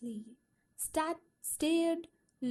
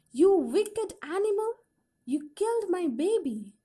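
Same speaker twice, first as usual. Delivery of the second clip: high, loud, frantic accusing scream; mother screaming at the mongoose she blames for her baby; hysterical scream; 5 semitones higher, 7 dB louder than usual. A narrator's reading. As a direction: scream it at the animal, beside yourself. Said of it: "she screamed hysterically".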